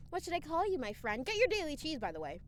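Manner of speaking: usual speaking voice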